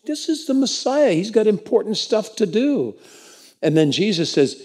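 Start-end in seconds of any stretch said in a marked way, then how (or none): 0.1-2.9 much higher, lightly voiced officious disciple; disciples scolding. Disciples shooing the beggar away, mock officious. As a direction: brush him off as a nuisance. Disciples rebuking the blind man.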